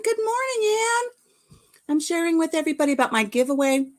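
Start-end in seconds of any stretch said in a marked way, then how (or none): 0.0-1.6 high singsong hello; cheery greeting. Real, not acted. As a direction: sing out hi to a friend. Greeting a viewer.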